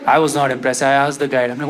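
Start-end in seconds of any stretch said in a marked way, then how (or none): none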